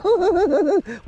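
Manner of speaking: short high giggle; high laugh. Real, not acted. Angler laughing at his own miss, high laugh. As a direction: laugh it off lightly.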